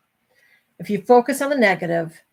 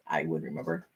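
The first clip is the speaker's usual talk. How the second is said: lightly put-on deep voice; low mock aside; 10 dB quieter than usual. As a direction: drop into a low joking aside. Joking aside, voice dropped low.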